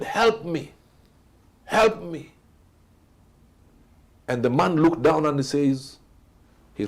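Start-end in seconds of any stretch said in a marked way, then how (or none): 0.0-2.3 retold shout for help. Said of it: "And he shouted".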